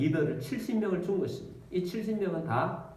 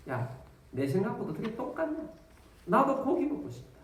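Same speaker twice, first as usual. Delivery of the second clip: lightly voiced neighbour, a bit higher; eager neighbour; 6 semitones higher than usual. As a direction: chime in with a hint of craving. People craving meat, neighbour agrees.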